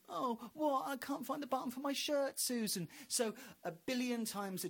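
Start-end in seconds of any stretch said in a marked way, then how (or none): 0.1-2.9 high, plaintive whine; mock whiny caller. Voice in Susan's head whining about a shirt button. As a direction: moan the small complaint.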